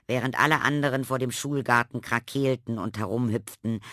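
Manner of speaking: plain reading voice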